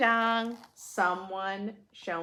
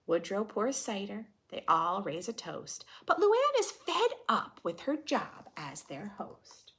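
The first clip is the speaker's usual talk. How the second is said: put-on lively read-aloud for kids; children's story narration; storybook reading; 5 semitones higher, 6 dB louder than usual. A narrator's reading. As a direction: read the rhyme to a class. Reading picture book aloud.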